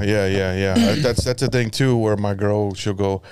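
normal talking voice

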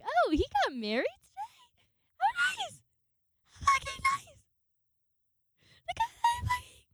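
very high, loud mock squeal; overdone "oh nice" in a piercing falsetto; shrieky fake happiness. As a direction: squeal through hammed-up devastation. Retold shock at crush's wedding.

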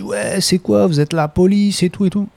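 high, indignant passenger voice; defensive mock retort. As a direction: snap back suspiciously as the drunk passenger. Passenger defensive about police.

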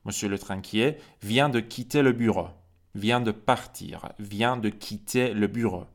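"Le métro vient de partir" said slowly, each word separate; slow, careful French for learners; slow model reading. Shown as a demonstration. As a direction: drill it slowly, stressing vient. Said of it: "reads French model sentences".

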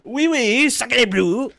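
loud, high mock French; hammy French exclamation; French accent. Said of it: "That's offensive".

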